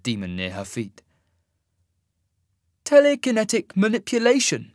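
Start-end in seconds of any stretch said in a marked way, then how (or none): 2.9-4.7 high, loud voice of an impressed immortal. A narrator's reading. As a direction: marvel at it in a raised voice. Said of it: "he said, sounding impressed".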